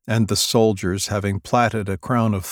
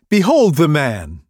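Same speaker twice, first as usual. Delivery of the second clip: higher, louder declaration; loud raised behold; proclaiming; 7 semitones higher, 8 dB louder than usual. Acted. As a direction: present him to the crowd. Pilate presents Jesus.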